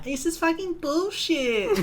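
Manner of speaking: high-pitched bratty kid mocking a return to school; high whiny kid. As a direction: whine it like a sulking schoolkid. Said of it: "I was a bit more high".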